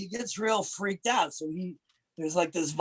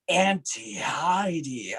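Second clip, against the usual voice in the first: AUNTIE HEIDI drawn out slowly; slowed-down name; much slower than usual. Shown as a demonstration. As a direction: give "Auntie Heidi" room to breathe. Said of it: "slow yourself down a little bit".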